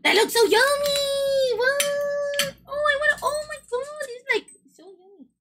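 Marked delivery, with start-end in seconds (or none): 0.0-4.2 excited about food. Real, not acted. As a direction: gush over how tasty it looks. Reacting to food gift.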